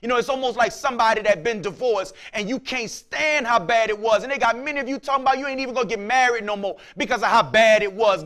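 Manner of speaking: ordinary talk, nothing marked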